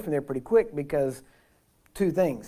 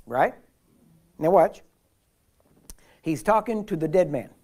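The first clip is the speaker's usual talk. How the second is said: ordinary delivery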